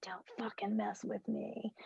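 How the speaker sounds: hushed threatening whisper; menacing whisper. Retold or imitated. When stressed, warning others off in a whisper. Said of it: "I'm like".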